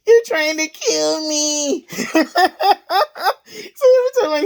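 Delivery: high-pitched, laughing coworker voice; laughing imitation. Retelling the older coworker's line, laughing high. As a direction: quote him while cracking up.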